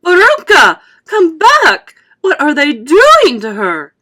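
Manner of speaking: panicked scream. A narrator's reading. Mother screams after Veruca, high and frantic. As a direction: shriek it in terror.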